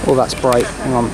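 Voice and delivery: usual speaking voice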